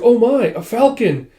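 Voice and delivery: high, loud, drawn-out startled cry; startled story heroine; acted alarm. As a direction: exclaim in mock alarm, slowly stretched. Girl sees falcon after a scream.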